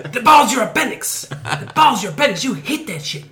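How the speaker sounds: loud, high cheering in a mock Scottish accent; weird Scottish accent. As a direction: yell encouragement in a hammed-up Scottish accent. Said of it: "that was a weird Scottish accent".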